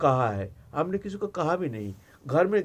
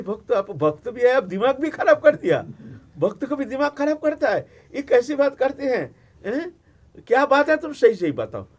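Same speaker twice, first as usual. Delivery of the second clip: astonished Parvati; 7 semitones higher, 4 dB louder than usual. Acted.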